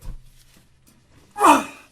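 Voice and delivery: loud strained groaning grunt. Real, not acted. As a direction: heave against the wrench. Straining on stuck bolt.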